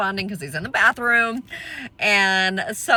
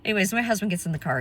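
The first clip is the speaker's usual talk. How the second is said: ordinary talk, nothing marked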